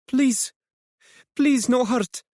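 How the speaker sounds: put-on high, desperate plea in a Middle Eastern accent. A narrator's reading. Hiding woman begging the police, pleading Middle Eastern accent. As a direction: beg in a Middle Eastern accent, rolled R's. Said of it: "It's not a British accent".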